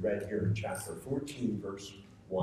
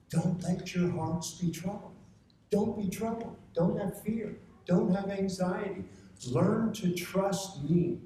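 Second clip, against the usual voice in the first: urgent comfort; 9 semitones higher than usual. Acted. Preacher voicing Jesus to congregation.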